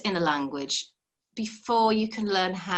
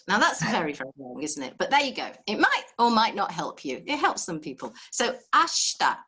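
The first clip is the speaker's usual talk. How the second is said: normal talking voice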